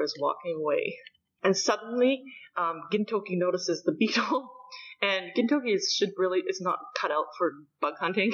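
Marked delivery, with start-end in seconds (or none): none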